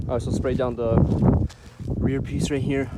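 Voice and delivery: breathless, panting between words; out of breath talking. Real, not acted. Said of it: "I'm sorry, I'm out of breath".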